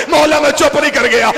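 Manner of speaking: refrain cried out high and loud; full-force preaching cry. Acted. Zakir crying Abbas's refrain to the mourners, full force. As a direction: hurl the refrain at the crowd. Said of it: "refrain at the climax".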